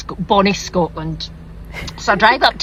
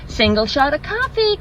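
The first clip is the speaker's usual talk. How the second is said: perky American barista repeating an order, pitched up; American accent; 5 semitones higher than usual. Acted. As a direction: sing it back in a chirpy American accent. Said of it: "Sorry, forgive the accent".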